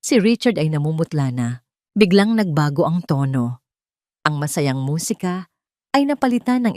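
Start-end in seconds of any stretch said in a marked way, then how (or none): none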